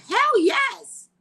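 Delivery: loud, high burst of delight; excited shout. Real, not acted. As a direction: blurt it out with joy. Eager reply to text offer.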